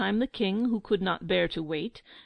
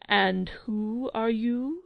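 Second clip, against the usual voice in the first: plain reading voice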